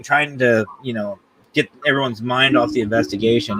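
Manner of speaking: ordinary delivery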